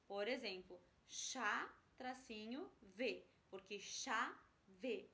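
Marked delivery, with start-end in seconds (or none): 1.1-3.2 "chá" and "V" set apart, hyphen named between; syllable separation. Shown as a demonstration. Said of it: "vocês vão separar". 3.8-5.1 "chá" and "V" as two separate slow beats; syllable by syllable. Shown as a demonstration. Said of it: "porque tem duas sílabas".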